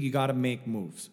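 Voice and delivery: normal talking voice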